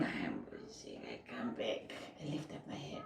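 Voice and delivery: drained labour whisper; whispered reenactment. Retold or imitated. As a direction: whisper it like you're spent from pushing. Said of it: "I'm, like, out of breath".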